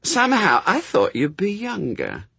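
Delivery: high-pitched, mild disappointment; slightly disappointed. A narrator's reading. Woman to the angel in his new body, slightly disappointed. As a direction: let a little disappointment show, pitched high. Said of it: "in a slightly disappointed voice".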